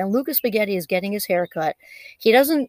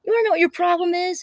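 higher, quick retold challenge; pitched-up, rapid quote with a touch of attitude; a little attitude; 8 semitones higher, faster than usual. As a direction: lightly imitate someone with attitude. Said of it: "with a little bit of attitude".

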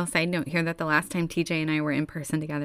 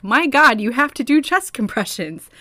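louder, exasperated doctors' outcry; exasperated exclaim; 4 semitones higher, 7 dB louder than usual. Retold or imitated. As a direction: cry out like horrified doctors.

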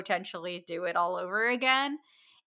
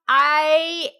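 laughing; 9 semitones higher, 7 dB louder than usual. Real, not acted. Laughing at body horror pitch.

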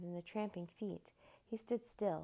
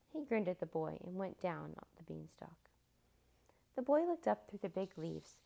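ordinary delivery, nothing marked

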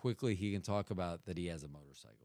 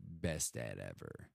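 much lower, softer, slow take on best dad ever; mock deep slogan; 10 semitones lower, 6 dB quieter, slower than usual. Shown as a demonstration. Telling Bobby best dad ever in a low mock voice. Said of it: "if I told Bobby".

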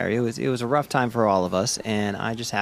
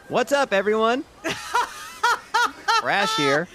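radio host greeting; 13 semitones higher than usual. Acted. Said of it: "live on the radio".

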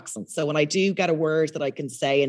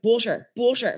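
struggling to be understood; 6 semitones higher than usual. Retold or imitated. Asking for butter in London, exasperated retold repeating. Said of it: "trying to get butter in London".

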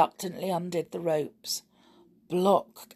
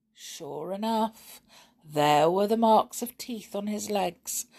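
plain reading voice

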